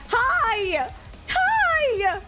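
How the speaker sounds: high squealed hi; excited hi. Real, not acted. Streamer greets a returning friend, squealing.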